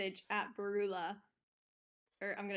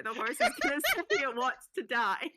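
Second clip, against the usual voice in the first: louder, higher, breaking up with laughter; laughing; 4 semitones higher, 6 dB louder than usual. Real, not acted. Player announcing a whisper about the horse, laughing. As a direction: crack up halfway through the line.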